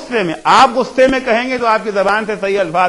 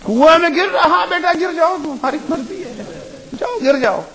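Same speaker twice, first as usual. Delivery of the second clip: lightly voiced indulgent parent, pitched higher; mock permissive parent; 9 semitones higher than usual. Permissive parent telling a child to fall in the well, mocking higher voice. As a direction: mimic a careless parent shrugging it off.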